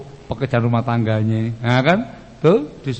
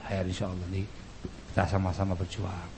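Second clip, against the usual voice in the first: low, soft agreement; hushed, conciliatory murmur; placating husband; 7 semitones lower, 11 dB quieter, faster than usual. Acted. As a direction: give in gently and soothe her. Husband placates the complaining wife.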